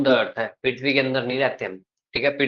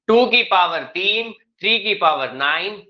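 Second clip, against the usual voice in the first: mock booming classroom drill, higher, louder and slow; loud teacher imitation; 5 semitones higher, 4 dB louder, slower than usual. Teacher mocking a loud colleague's lecturing, hammed-up loud and slow. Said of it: "बहुत loud बोलते हैं ना".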